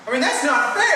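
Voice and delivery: loud indignant protest. Acted. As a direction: protest it, rising. Complaining about taxi.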